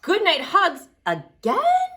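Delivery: ordinary talk, nothing marked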